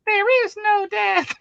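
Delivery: very high, slow movie impression; Tangina. Quoting Poltergeist to a co-host, high character voice. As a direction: draw it out in a tiny high voice. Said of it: "that movie with Tangina".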